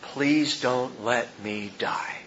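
soft, slow, pained murmur of a dying man; faint dying plea. Retold or imitated. Dying president mouthing his last words, faint and slow. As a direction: barely mouth the words as life slips away. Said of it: "mouthing these final words".

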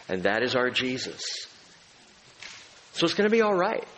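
usual speaking voice

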